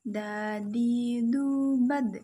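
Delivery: "bad" loud with a bounced d; letter da drill. Shown as a demonstration. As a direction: model the syllables.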